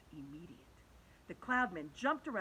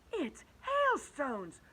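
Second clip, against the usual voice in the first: slow, higher, thrilled realisation; light up at the discovery; excited discovery; 8 semitones higher, 7 dB louder, much slower than usual. Acted. James realising what the cloudmen make, thrilled. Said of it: "whispered James excitedly".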